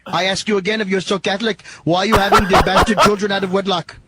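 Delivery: a light, hammed-up Indian accent; put-on Indian accent. Prank caller quizzing the Irishman, in a light mock Indian accent. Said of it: "stupid of an accent".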